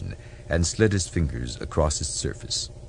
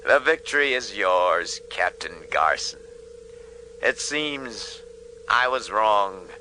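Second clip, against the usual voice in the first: defeated admiral; 4 semitones higher, slower than usual. Acted. Iota concedes defeat.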